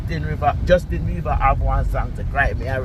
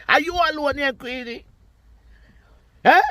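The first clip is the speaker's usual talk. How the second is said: high taunting question; 12 semitones higher than usual. Real, not acted. Taunting Queenie again.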